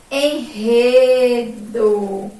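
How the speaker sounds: "Enredo" stretched very slowly, syllable by syllable; syllable demonstration. Shown as a demonstration. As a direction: draw out en-re-do with a strong h-like R. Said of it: "no início de uma sílaba".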